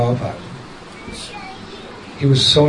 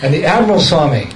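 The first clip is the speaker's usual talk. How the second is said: normal talking voice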